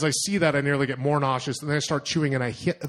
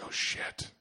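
breathless whispered oh shit under the breath; panicked whisper; 11 dB quieter than usual. Retold or imitated. Traveler whispering to himself, spotting the glaring men, panicked whisper. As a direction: whisper it in sudden alarm, barely voiced.

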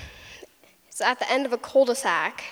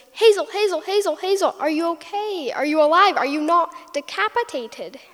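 high, loud, frantic calling; worried mother calling her child in a panic; panicked mom; 5 semitones higher, 3 dB louder than usual. Retold or imitated. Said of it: "she goes".

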